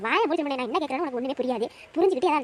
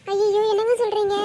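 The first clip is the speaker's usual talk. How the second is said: ordinary delivery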